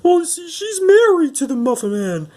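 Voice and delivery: very high squeaky cookie voice; gingerbread boy. Retold or imitated. Cookie explains who married whom, high squeaky falsetto. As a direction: explain it in a thin put-on falsetto. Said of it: "and then eventually he goes".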